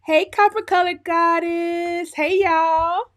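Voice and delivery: high, slow, loud welcome call; drawn-out singsong hello; sing-song greeting. Real, not acted. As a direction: stretch out each hello like singing to the room. Sing-song greetings to stream viewers.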